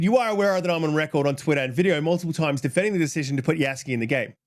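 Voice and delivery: fast, rattled-off reread of the tweet; rushed reread. Retold or imitated. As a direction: rush through it, you've said it already. I'll go back to start.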